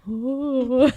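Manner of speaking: mock ghostly moan; low haunting voice. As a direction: hum it like a sad ghost. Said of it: "they sound a little more like this".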